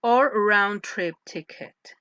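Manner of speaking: "or round-trip ticket" said slowly, tune stepping down to the end; falling intonation. Shown as a demonstration. Teacher showing students the second option, falling intonation. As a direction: let "ticket" fall to close the choice. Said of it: "The intonation starts going down".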